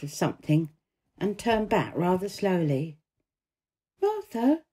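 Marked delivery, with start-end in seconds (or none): 4.0-4.6 high, lightly voiced girl; a hesitant lifted call; Mary calling Martha. A narrator's reading. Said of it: "she said".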